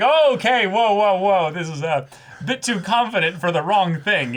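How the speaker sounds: high, loud, hammed-up startled voice; overwhelmed reaction. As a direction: back off in mock alarm. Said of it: "They're just like".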